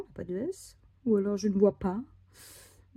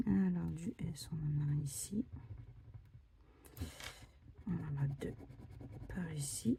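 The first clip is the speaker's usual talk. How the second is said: whisper; 3 semitones lower, 11 dB quieter than usual. Real, not acted. Scratching ticket, murmuring to self.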